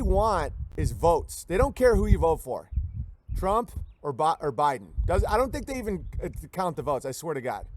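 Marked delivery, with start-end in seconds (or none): none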